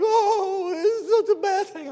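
high, whiny fake sobbing; mock crying. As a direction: ham up crocodile tears like a caught criminal. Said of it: "And I'm like".

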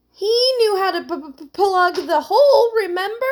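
high, loud stutter from cold; shivering. A narrator's reading. Heidi reminding Emily about Terrence, shivering.

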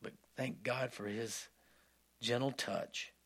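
ordinary delivery

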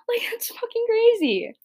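very high, squeaked disbelief; incredulous outburst. Real, not acted. Podcaster reacting to adults without direction, high-pitched incredulity. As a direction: voice shoots way up in disbelief.

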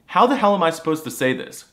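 usual speaking voice

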